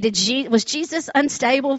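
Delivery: usual speaking voice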